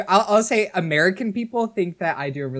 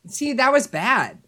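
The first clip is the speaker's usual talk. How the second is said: usual speaking voice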